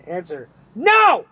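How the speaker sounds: NO punched loud and slow; emphatic no. Shown as a demonstration. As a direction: stress the word no hard. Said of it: "I had to say it like that".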